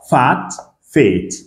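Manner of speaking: fat with a short a, fate as "fet"; short versus long A. Shown as a demonstration. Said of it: "le son qui est court et ... qui est long".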